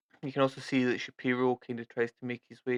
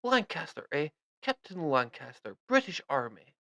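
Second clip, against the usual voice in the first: higher lightly British introduction; a hint of a British officer; British captain; 3 semitones higher than usual. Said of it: "he's like".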